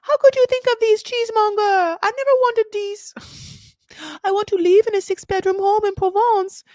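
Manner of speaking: mock the wife whining at her husband; shrill whiny wife. Mocking the wife scoffing at the cheese farm in a high whine. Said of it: "She's like".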